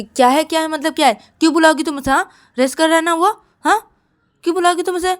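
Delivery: loud, high, rapid-fire challenges; quarrelsome scolding. Acted. Shruti quarrels with Siya over waking him, angry.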